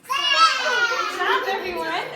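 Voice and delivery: high, loud cheer of praise; bright loud good job; excited praise. Real, not acted. Praising kids loudly.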